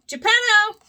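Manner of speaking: high, loud call of a name; calling for Geppetto in an empty house. Acted. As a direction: call for him across the house. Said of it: "Pinocchio called".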